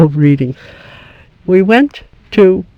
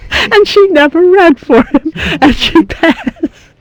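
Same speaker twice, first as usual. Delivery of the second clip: laughing high through the words; can't stop laughing while telling it; giggling at the happy ending; 12 semitones higher, 6 dB louder than usual. Real, not acted. Laughing at the judge's pass.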